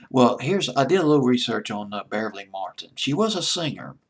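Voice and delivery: ordinary talk, nothing marked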